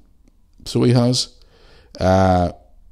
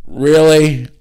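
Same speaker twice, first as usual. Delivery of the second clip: mock disbelief; 7 semitones higher, 7 dB louder than usual. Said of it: "I was standing there going".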